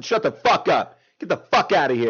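loud fake outrage, telling him off; mock angry shout. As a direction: bark it like a fed-up host. Mock angry outburst at cohost.

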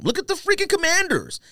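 ordinary talk

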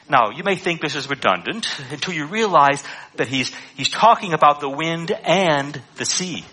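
ordinary talk, nothing marked

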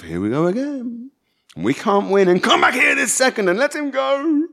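high, rising, exasperated calls; overlapping parents' cries. A narrator's reading. Mum and Ian as Shai slams the door, exasperated high calls. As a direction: call after him, pitch climbing. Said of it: "the overlapping sounds of".

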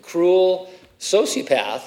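usual speaking voice

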